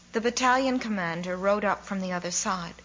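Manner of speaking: usual speaking voice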